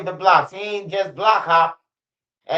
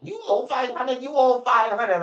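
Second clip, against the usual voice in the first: mock demand; 6 semitones higher than usual.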